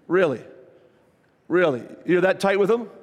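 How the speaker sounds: mock-incredulous questioning; making fun. Preacher ribbing Christians who claim the devil chases them, mock disbelief. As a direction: tease with pointed, separate questions. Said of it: "Yeah, I'm making fun".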